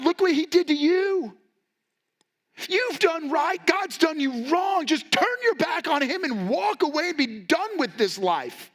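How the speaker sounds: high mocking wife voice, pitched well up. Preacher voicing Job's wife urging him, high and scornful. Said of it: "his wife said to him".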